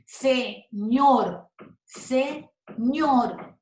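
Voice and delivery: "Señor" broken in two, ñor harder; slow SE, then a strong ÑOR in "señor"; stressed last syllable. Shown as a demonstration. Teacher showing students the tonic syllable, stress on ÑOR in "señor". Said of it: "suene más duro el ñor".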